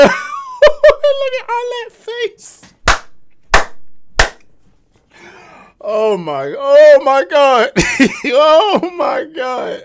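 high squealing laugh through words. Real, not acted. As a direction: crack up, high and slow. Cracking up at a contestant.

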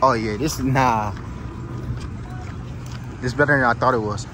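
ordinary talk

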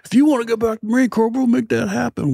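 angry instructor threat. Retold or imitated. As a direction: jab the words like a finger in the face. Instructor jumps up, finger in face.

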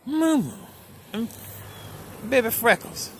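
high, loud name call; high ringing call. Real, not acted. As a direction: call both cats. Calling cats by name.

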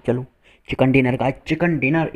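ordinary talk, nothing marked

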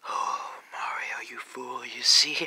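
slow villainous whisper swelling to a harsh shout; weird evil voice. Acted. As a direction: build from creepy hush to roar. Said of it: "that weird evil voice".

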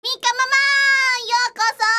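high, loud cheerful greeting; excited welcome. Real, not acted. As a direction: call out a bright welcome.

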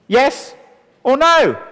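loud, high yes or no; emphatic options. Shown as a demonstration. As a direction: punch both answers out. The two answers people can give.

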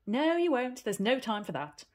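firm. Acted. Aunt refusing Heidi's visit to Granny, firm and loud. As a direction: shut the request down flat. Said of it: "said Detty firmly".

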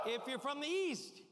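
crowd call. Shown as a demonstration. Speaker rallying the east side, loud call. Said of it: "could I hear you give a big whoopee".

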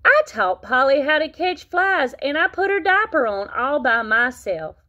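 high, loud eager brag; little kid showing off; proud boasting. Acted. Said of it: "said Froggy".

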